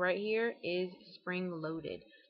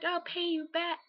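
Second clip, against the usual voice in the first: high, eager plea; pleading promise; 10 semitones higher than usual. Retold or imitated. Bargaining with her mom, a high eager promise. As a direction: promise it, pitched up and hopeful. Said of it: "I'm like".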